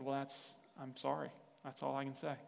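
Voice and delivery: softly retold, resigned apology; subdued apology. Apologizing to a hurt friend who won't forgive, softly resigned. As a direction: say sorry quietly, with nothing left to offer.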